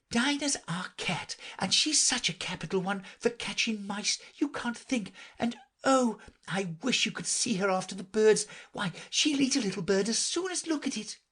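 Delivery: high, excited chatter; eager girl. A narrator's reading. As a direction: gush about her pet. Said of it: "Alice replied eagerly".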